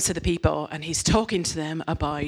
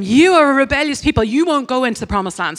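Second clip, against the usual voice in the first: louder, higher scolding; mock stern rebuke; 7 semitones higher, 8 dB louder than usual. God telling the rebels, louder stern rebuke. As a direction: scold them like an exasperated parent.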